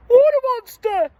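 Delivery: very high, loud scared shriek; storybook fright, very high; frightened cry. A narrator's reading. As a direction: shriek at the monster. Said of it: "and one cried".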